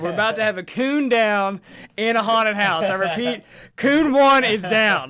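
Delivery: lightly voiced emergency dispatcher, slowing on the callout; mock radio distress call. Joking to friend about collapsing in haunted house, mock distress call.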